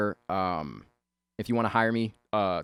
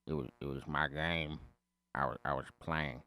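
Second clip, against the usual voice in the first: timid mumble, softer; 5 semitones lower, 7 dB quieter than usual. Retold or imitated. Timid character answering bully.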